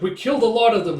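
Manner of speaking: loud boast. Retold or imitated. Villager boasts of killing.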